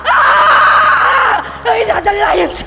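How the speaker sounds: high, loud battle cries; battle shout. Retold or imitated. Preacher reliving the army's battle cry, loud shouting. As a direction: roar it like an army charging. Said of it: "And I hear us shouting".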